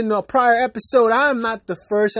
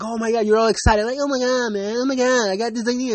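mock excitement, pitched up a little; excited voice; 2 semitones higher than usual. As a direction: put on an over-eager excited voice. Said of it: "That's just my voice for excited".

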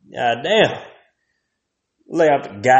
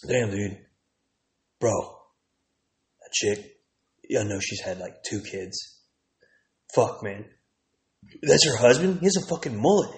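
low, much softer aside; hushed, lower bro gossip; muttered gossip; 4 semitones lower, 6 dB quieter than usual. Retold or imitated. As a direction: murmur like guys talking behind your back. Young guys murmuring about his wife.

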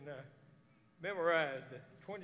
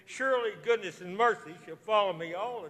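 fervent psalm recitation; 3 semitones higher, 15 dB louder than usual. Real, not acted. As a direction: proclaim each line like a prayer.

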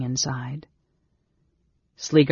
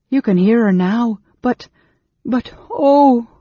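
gasping between sobs; 8 semitones higher, 8 dB louder than usual. A narrator's reading. Servant girl reassuring the innkeeper, gasped between sobs. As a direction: act a sobbing girl, pitched way up. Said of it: "gasp between sobs".

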